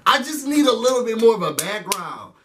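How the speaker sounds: ordinary talk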